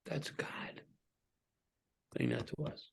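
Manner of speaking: breathy hushed whisper; almost no voice, intimate. Real, not acted. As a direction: pastor whispering to congregation. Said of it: "hushed aside after God's words".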